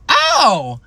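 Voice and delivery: high, loud drawn-out oh; shocked realization. Retold or imitated. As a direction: act out an offended discovery. Discovers what the word means.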